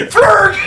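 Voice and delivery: high laughing gibberish grunt; a mock furious puppet; angry Swedish Chef noise. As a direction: spit out the nonsense word.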